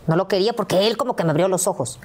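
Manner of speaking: ordinary talk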